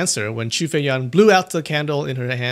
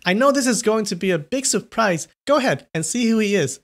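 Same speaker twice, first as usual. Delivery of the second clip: exclaiming; 8 semitones higher than usual. A narrator's reading. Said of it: "she exclaimed".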